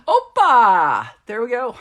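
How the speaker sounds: big playful shout; loud, mock-triumphant whoop; exuberant Greek cheer. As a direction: belt it out like a party cheer. Shows off her practiced Greek.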